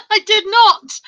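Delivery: high, loud denial through laughter; real giggly outburst; laughing protest. Real, not acted. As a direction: protest while cracking up. Laughing through her own story.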